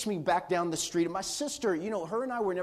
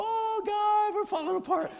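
mother losing it, shrieking in high panic; frantic mom. Retold or imitated. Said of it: "My mom".

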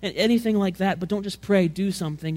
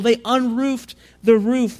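They unroofed the roof, slow, higher and louder; stressed phrase; 5 semitones higher, 6 dB louder, slower than usual. Shown as a demonstration. Preacher repeating They unroofed the roof with slow emphasis. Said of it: "This is not just cutting that neat hole".

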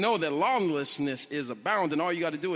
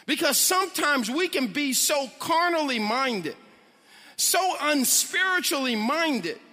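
preaching; 6 semitones higher than usual. Acted. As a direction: preach it with rising passion. Preaching builds to full force.

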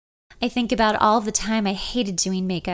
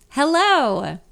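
bright, higher, louder hello; cheerful greeting; 6 semitones higher, 5 dB louder than usual. Acted. Ben poking his head in, cheerfully greeting her. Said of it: "he says cheerfully".

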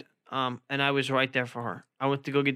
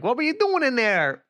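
much higher, louder mock girlfriend voice; 10 semitones higher, 3 dB louder than usual. Boyfriend mimics girlfriend's question, high mocking voice. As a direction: squeak it out like you're imitating her. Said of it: "said, like".

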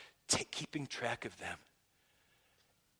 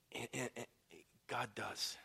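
usual speaking voice

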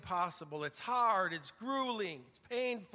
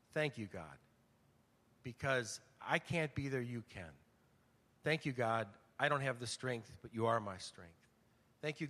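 sincere prayer; 7 semitones lower than usual. Retold or imitated. Retold heartfelt prayer in car.

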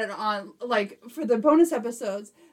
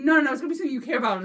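rapid-fire dismissive no; quick refusal; 2 semitones higher, much faster than usual. Retold or imitated. Fiance refusing the deal, fast dismissive. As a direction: rattles it off fast. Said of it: "She's like".

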